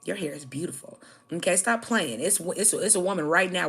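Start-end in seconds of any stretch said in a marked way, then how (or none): 0.0-1.0 a hushed, breathy whisper of praise; whispered compliment. Retold or imitated. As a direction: whisper it like a secret aside. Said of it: "and I'd be like".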